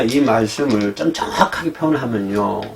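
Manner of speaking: normal talking voice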